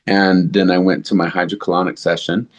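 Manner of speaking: usual speaking voice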